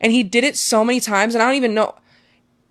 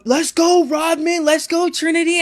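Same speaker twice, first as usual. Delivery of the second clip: dad cheering from stands; 9 semitones higher than usual. Retold or imitated. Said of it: "So I hear him go".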